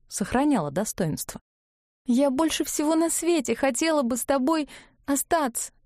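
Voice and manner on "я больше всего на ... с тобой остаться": high, tearful plea; sobbing. A narrator's reading. Ivga pleads with Klavdiy, near sobbing. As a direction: speak through tears, pitched up. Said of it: "рыдала Ивга".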